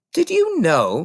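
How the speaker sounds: concerned librarian thinking aloud; librarian Mrs Phelps. Acted. Mrs Phelps worrying about Matilda, hesitant librarian voice.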